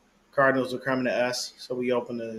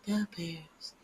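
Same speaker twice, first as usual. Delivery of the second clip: much softer, slightly higher imitation; softly said; 4 semitones higher, 11 dB quieter than usual. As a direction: mimic a soft-spoken remark, barely projecting. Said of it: "just softly said".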